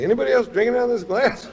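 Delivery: high, fast, loud demand; mock-outraged shout to the room; indignant calling out. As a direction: act out accusing the whole party. Calling out, puzzled by empty glass.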